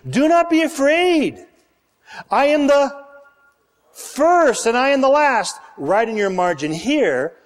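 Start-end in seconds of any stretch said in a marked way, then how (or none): 0.1-5.6 proclaiming. Acted. Reading Jesus' words with force.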